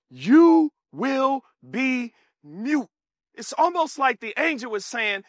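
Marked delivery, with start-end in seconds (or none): 0.1-2.9 very slow, drawn-out sentence; solemn pronouncement. Acted. Angel sentencing Zacharias to silence, slow and stern. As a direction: stretch every word like a verdict.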